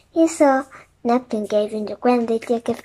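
ordinary delivery

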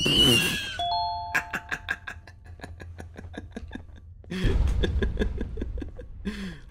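high hysterical breathless laugh; shrieking laugh. Real, not acted. Relief after chase.

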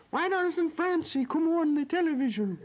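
high-pitched attempt at a Scottish woman; mother's shrill-pitched mock Scotch voice; bad Scotch accent. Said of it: "I can't do a Scotch accent".

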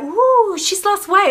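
high, squealing "ooh" of admiration; admiring gossip. Retold or imitated. Friends gushing over her weight loss, high admiring squeal. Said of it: "It wasn't like".

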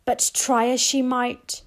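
usual speaking voice

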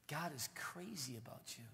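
whispered secret; hushed whisper of God's love. Real, not acted. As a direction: lean in and whisper it. Intimate aside to congregation.

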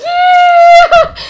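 very high, loud mock cheer; imitated excited yell. Girl mimicking her sister's overexcited yell. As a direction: squeal it like an overexcited fan. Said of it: "She's like".

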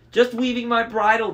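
high, loud falsetto bride-to-be. A narrator's reading. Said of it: "in his falsetto voice".

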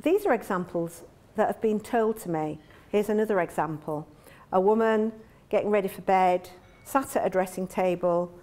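ordinary talk, nothing marked

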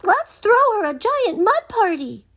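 very high, squeaky piglet voice; squealing pig. Acted. Little Dude the pig pitching a party, high squeal. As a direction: squeal it out with glee. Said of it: "Little Dude squealed".

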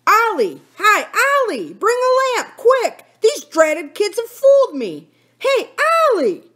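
high, loud angry calling; shouting. A narrator's reading. Jake calling Ollie after losing his lantern, shouting. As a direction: bellow for your partner in the dark. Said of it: "shouting for the other man".

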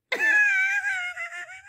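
high crying howl of laughter; shrieking laugh. Real, not acted. Woman howls laughing at the culé joke, shrieking laugh.

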